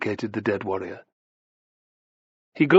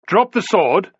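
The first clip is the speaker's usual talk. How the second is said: loud, high-pitched command; barked order; 9 semitones higher, 7 dB louder, slower than usual. A narrator's reading. Fortis to the defiant slave gladiator, a loud order. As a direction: order an armed man to disarm. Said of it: "ordered Fortis".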